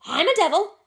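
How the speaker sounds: high, loud squawking raven; raven's croak. A narrator's reading. Grip the raven answering Barnaby, squawking loud. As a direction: croak it out like a talking bird. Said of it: "rejoined the raven promptly".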